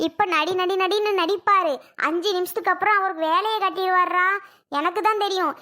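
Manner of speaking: usual speaking voice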